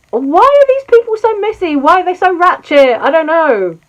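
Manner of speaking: high, loud, incredulous squeal of disbelief; shrieking at how messy they are; exasperated outburst. Real, not acted. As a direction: throw your hands up at the mess, loud and high. Exasperated rant about celebrity drama.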